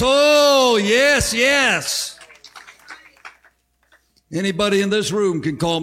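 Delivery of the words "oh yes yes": loud, high cries of joy; preacher's rapturous affirmation; ecstatic yes. Real, not acted. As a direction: swept up in the moment.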